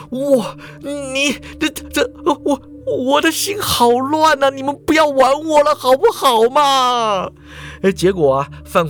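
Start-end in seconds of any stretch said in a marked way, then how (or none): 0.1-7.3 高声结巴地求饶; 心乱如麻，结结巴巴地央求; 慌乱哀求. A narrator's reading. 范狂心烦意乱地哀求. (范狂心烦意乱地求大家别再玩他)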